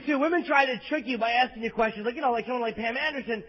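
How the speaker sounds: normal talking voice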